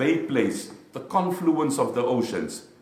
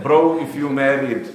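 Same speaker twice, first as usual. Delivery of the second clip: louder, slow, calling out to the married brothers; loud teasing call; 3 semitones higher, 10 dB louder than usual. Real, not acted. Preacher teasing the married men in the crowd, loud and slow. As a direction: lean on bro and tease them loudly. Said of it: "loud teasing repeat".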